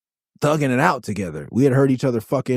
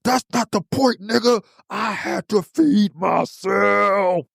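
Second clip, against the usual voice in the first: much higher, slow shouted outrage; mock furious shout; 9 semitones higher, much slower than usual. Aesop shouting after throwing money in his face, mock furious shout. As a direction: scream it in his face, dragging out feed. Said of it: "threw the money in my face".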